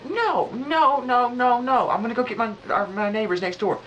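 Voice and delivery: the roommate fired up, squeaking over the phone. Retold or imitated. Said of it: "the voice sounds just like him".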